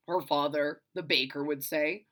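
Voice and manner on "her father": usual speaking voice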